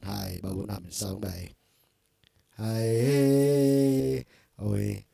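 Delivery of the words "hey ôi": loud, auto-tuned singing call; pitch-tuned vocal demo. Shown as a demonstration. Teacher sings a line to show the pitch effect, loud and tuned. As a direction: belt it through the tuner. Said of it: "Những effect khác nhau".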